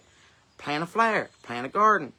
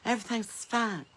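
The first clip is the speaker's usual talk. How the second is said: mock reassurance; 5 semitones higher than usual. Mocking the news telling her it's all fine, in a soothing sing-song. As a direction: parrot the people who pat you on the head. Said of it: "be like".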